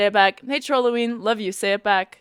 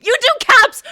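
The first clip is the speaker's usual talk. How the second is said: loud, high-pitched protest; indignant shout; 8 dB louder than usual. Real, not acted. Streamer accuses viewer of yelling, indignant high shout. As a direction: yell the accusation back at them. Protests that chat yells in caps.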